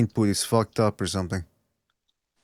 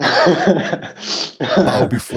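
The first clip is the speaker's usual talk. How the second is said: very slow, laughing through heavy distortion; distorted villain voice; 3 dB louder, much slower than usual. Pretend. Host gloating at viewers in a slow distorted villain voice. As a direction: drag it out like a gloating overlord. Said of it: "You sound like the fucking killer from Scream".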